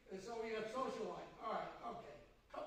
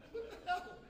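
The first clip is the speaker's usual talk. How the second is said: laughing; 11 semitones higher, 3 dB louder than usual. Real, not acted. Laughing at his own story.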